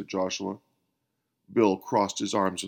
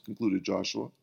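ordinary delivery

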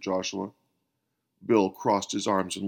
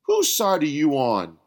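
1 indignant challenge; 5 semitones higher, 5 dB louder than usual. A narrator's reading. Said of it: "made Bill scowl".

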